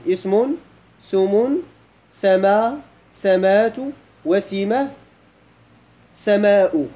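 "اسم، سم، سما، سمات، وسمة، سماء" as a measured count, mostly lifting at word ends; counting item by item. Shown as a demonstration. Teacher counting word variants for students, item by item. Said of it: "شوفوا لاحظوا معايا واحد".

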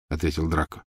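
usual speaking voice

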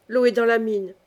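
plain reading voice

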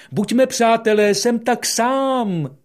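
high, loud, slow lonely call. Acted. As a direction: call out for a friend. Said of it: "řekl". (osamělé volání)

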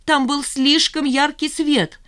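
very high, drawn-out desperate wail; despairing cry. A narrator's reading. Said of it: "в отчаянии закричала Жанна". (отчаянно, высоко и протяжно)

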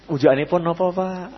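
soft, gentle, humble offering; refined wife. Retold or imitated. Wife politely offers her husband food, soft and humble.

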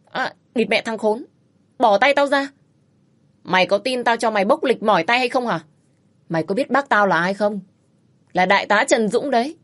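husband in an armlock threatening the man; pained bluster. Acted. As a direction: yelp in pain then bluster. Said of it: "kêu nhẹ".

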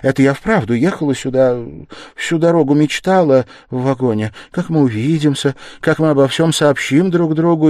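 high, loud, emotional mother; flustered gushing. A narrator's reading. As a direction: gush happily to cover the embarrassment. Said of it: "заговорила в смущении Пульхерия Александровна".

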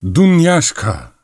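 high, slow calling shout; old woman's insistent call for a servant; repeated yell. A narrator's reading. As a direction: holler the name, drawn out. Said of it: "diye tekrarladı koca karı".

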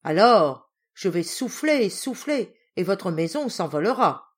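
loud threatening wolf; wolf shouting at the two pigs behind the door; wolf threat. Acted. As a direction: boom out the threat to blow the house down.

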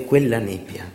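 normal talking voice

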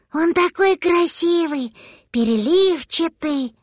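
put-on high, shy childlike voice, slowing; sheepish little dragon. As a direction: confess like a bashful kid. Dragon confesses.

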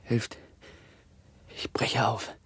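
faint, half-whispered cry for help; weak dying whisper; fading plea. Acted. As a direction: let the plea die away softly. Same plea, fading away.

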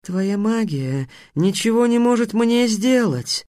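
high, slow boast; defiant sphinx. Acted. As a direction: taunt the wizard with stretched words.

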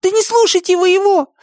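very high-pitched urgent plea; alarmed protest. A narrator's reading. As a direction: cut in desperately in a squeaky voice. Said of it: "обратился он к дракону".

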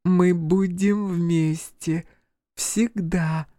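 put-on high, slow, eerie child promise; a dead sister coaxing, drawn out; Joanna's voice. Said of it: "Голос Жоанны".

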